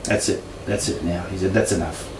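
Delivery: low, hushed retold decision; quiet finality. Father deciding to sell the house, quietly final. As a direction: drop low and soft, imitating a father who's done.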